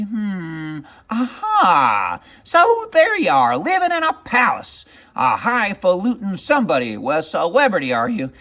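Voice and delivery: high, cackling witch voice, rising in spite; triumphant witch. Acted. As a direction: gloat as a spiteful little witch. Witch finds Scamp.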